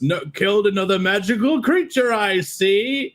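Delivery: a hint of a wry old guardian; mild tree voice noting the kill; lightly voiced old tree. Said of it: "He says".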